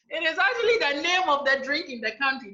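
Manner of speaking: ordinary talk, nothing marked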